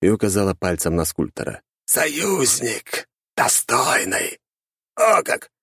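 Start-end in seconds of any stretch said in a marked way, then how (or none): 1.9-4.4 eldritch horror. A narrator's reading. Inhuman figure names an ally. 4.9-5.5 high, sharp surprised skeleton. A narrator's reading. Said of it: "удивился Герхард".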